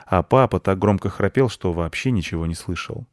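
ordinary delivery, nothing marked

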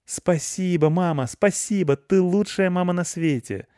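put-on high, gushing child voice; overjoyed boy. A narrator's reading. Boy thanking mother for allowing the dog, overjoyed. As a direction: thank mum with bursting delight.

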